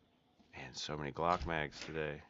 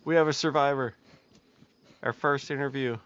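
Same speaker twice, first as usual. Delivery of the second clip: loud, high hammed-up news announcer; mock reporter; 10 semitones higher, 14 dB louder than usual.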